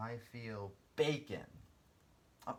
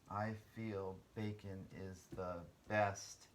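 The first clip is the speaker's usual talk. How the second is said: slow reading aloud; 3 semitones lower, 3 dB quieter, slower than usual. Shown as a demonstration. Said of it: "teacher rereads sentence slowly".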